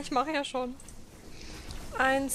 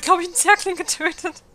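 laughing; 7 semitones higher, 11 dB louder than usual. Real, not acted. Oops, killed own unit.

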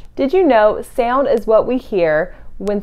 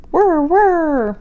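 higher whirring hum; whirring wings; 7 semitones higher than usual. Acted. Reading aloud, voicing hummingbird wings whirring higher.

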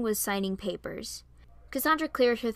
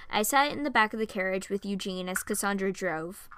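plain reading voice

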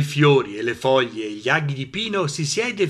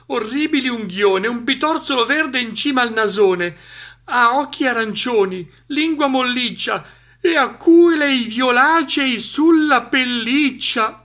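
high-pitched suspense, building louder; mounting alarm; 10 semitones higher, 5 dB louder than usual. A narrator's reading. Narrator reveals the monster piece by piece, mounting alarm. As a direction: build toward a panicked reveal.

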